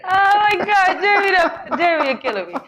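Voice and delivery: laughing hard at a joke; cracking up with laughter. Real, not acted.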